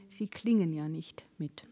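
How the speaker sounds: normal talking voice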